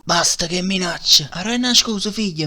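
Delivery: loud desperate cry; shouting. Acted. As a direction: yell it out in panic. Desperate outburst.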